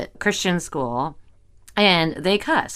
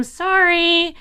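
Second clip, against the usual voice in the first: high, slow imitated teacher; teacher calling; 9 semitones higher, much slower than usual. Teacher phoning mother about cussing, high apologetic imitation. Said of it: "several calls from, from teachers".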